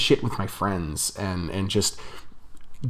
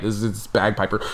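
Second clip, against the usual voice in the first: ordinary talk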